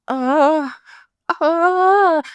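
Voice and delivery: mock wordless "uh" rising into a squeal; flustered wail. Acting out her flustered reply.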